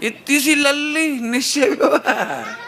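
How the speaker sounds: put-on a mother marvelling at her tiny girl; amazed mother. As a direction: mom fondly amazed, laughing. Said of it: "तो mummy कहेगी".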